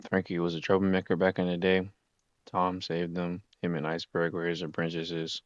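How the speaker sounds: normal talking voice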